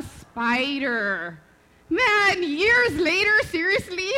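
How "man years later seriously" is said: high, loud comic exasperation; incredulous exclamation. Real, not acted. Mother exclaims over yet another spider, loud incredulous outburst. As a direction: cry out in disbelief, about to laugh.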